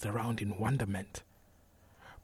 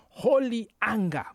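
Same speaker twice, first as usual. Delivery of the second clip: high, louder proclamation; righteous exclamation; 11 semitones higher, 6 dB louder than usual. A narrator's reading. Narrator quoting Sister Odolo's name for rage at sin, righteous exclamation. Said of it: "she called it".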